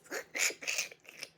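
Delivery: airy giggle; breathy laugh. Real, not acted. Reader cracking up at her own joke, laughing.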